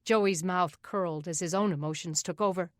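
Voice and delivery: usual speaking voice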